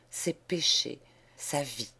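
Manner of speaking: usual speaking voice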